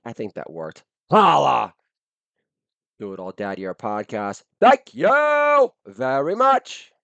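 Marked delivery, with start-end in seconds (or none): 1.1-1.7 loud high holler; catchphrase shout. Acted. Host opening the podcast with a loud holler. 4.6-6.9 very high, slow, drawn-out thank you; mock showman thanks. Host thanking listeners in a high hammed-up voice.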